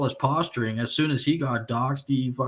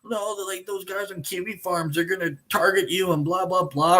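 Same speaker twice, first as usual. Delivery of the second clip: mock begging, pitched up; higher-voiced imitation of someone pleading; imitated pleading; 6 semitones higher than usual. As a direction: retell his begging in a higher voice. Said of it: "begging me and begging me".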